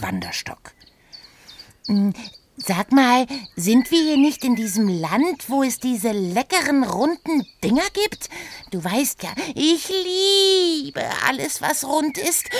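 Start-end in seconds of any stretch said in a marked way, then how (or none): 1.9-12.5 hohe, neugierige Figurenstimme; Oda, verspielt. Acted. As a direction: quirlig und naschhaft nachfragen. Oda speaks, held high voice. (hohe Stimme, schwärmerisch gedehnt)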